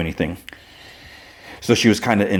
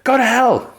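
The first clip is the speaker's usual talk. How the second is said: very high-pitched mock scream; high, strained outburst, not full volume; retold scream. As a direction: let out a sudden high scream. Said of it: "he lets out a scream".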